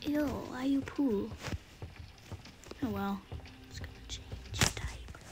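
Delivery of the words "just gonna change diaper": barely-there whisper, reading the task; whispering to self. Real, not acted. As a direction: mutters the chore in a hush. Reading task list under breath.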